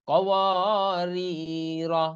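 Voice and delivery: slow, drawn-out Quran chant; Quranic recitation. Shown as a demonstration. Teacher recites the joined word for the students after spelling. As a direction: recite it slowly and sustain the vowels.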